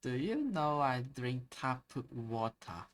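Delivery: slow, drawn-out imitation of an Australian accent; [name]'s Australian accent. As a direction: mimic an Australian accent, stretching the words out. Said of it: "really good job at mimicking the accent".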